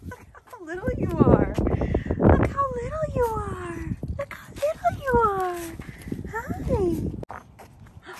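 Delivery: high, stretched-out adoring coo. Real, not acted. Adoring the tiny puppy, high cooing baby talk. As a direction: melt over how tiny the puppy is.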